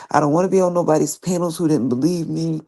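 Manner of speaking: ordinary delivery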